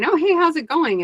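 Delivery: high-pitched, casual mock hello; chirpy greeting. Asking someone in a social situation, chirpy and high. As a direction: pitch it way up, friendly small talk.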